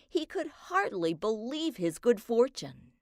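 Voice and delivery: ordinary delivery, nothing marked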